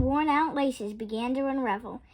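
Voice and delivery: plain reading voice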